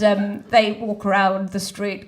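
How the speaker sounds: ordinary talk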